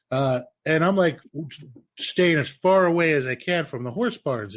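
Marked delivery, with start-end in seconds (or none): none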